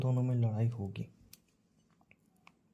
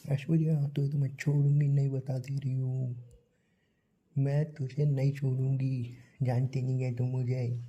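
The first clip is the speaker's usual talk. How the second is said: mock angry rant; 2 semitones higher than usual. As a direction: play the furious contestant yelling in her room, drawn out. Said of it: "तुमने अपने room में चिल्लाया".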